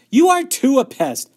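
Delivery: high, loud sibling retort; yelling back. A narrator's reading. Older sister answers her little sister's denial, yelling back. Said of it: "Beezus yelled back".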